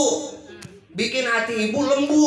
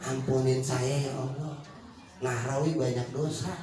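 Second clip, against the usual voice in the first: low, slow and much softer plea; whisper-like humble repentance; soft istighfar; 10 semitones lower, 5 dB quieter, slower than usual. Shown as a demonstration. Preacher demonstrates quiet istighfar to the women, low and slow. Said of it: "Itu istighfar".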